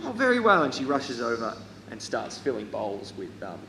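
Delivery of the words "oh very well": high-pitched obliging woman; high, louder lady's voice; fussing lady. Acted. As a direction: answer as the fussy hostess, pitched up. Same lady answering the players.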